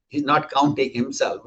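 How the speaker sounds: ordinary talk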